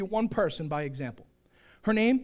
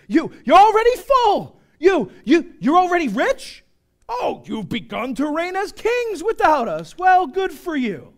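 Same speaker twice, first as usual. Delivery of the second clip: loud, high mock amazement; hammed-up sarcastic congratulation; 9 semitones higher, 11 dB louder than usual. Preacher voicing Paul to Corinthians, loudly sarcastic. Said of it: "He's being sarcastic".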